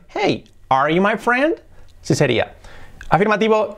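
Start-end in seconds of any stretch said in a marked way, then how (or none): 0.1-1.6 high-pitched "Hey", then "friend" rising sharply; slow, lifted question, climbing on "friend"; question intonation. Shown as a demonstration. Teacher adding intonation for learners, rising question on "friend". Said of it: "Y le damos la entonación".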